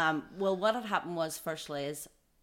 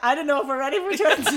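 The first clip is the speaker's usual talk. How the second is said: higher, louder, laughing through the words; husband's panic told with laughter; husband worried about twins, laughed; laughing imitation; 10 semitones higher, 13 dB louder than usual. Husband's worry retold laughing.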